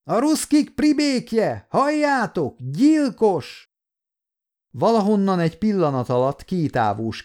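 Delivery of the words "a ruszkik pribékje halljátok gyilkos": very high-pitched frenzied accusation; raging outburst. A narrator's reading. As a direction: act out a madman's fury, pitch leaping up. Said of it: "Dühöngve az őrültek erejével".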